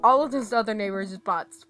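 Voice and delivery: ordinary talk, nothing marked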